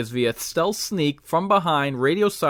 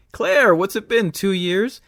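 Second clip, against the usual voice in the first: hero reunion voice; 6 semitones higher than usual. Pretend. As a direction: greet an old friend brightly.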